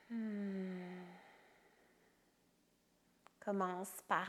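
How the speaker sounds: calm meditation guide with long pauses between phrases; guided meditation. Acted. Yoga teacher guiding a resting listener through yoga nidra, soothing meditation voice. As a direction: guide them into rest, phrase by phrase, leaving silences.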